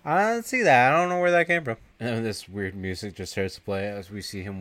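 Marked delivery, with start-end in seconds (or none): 0.0-1.8 higher, louder fake innocence; mock innocent denial. Bert denying he knocked over the display, loud and higher. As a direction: play dumb loudly.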